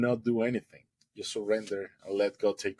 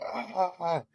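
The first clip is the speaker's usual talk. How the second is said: high, panicked little cries; frightened cries; 9 semitones higher than usual. Retold or imitated. Swimmer waiting for the shark bite, panicked high cries. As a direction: yelp in terror as the thing rushes in.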